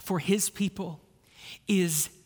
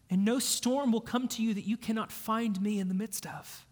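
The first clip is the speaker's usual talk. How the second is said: softer, intimate reassurance, partly whispered; tender Christ; 5 dB quieter than usual. Pretend. Jesus comforting sinking Peter, tender and hushed. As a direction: hold him close with your voice. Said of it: "as if Christ is saying".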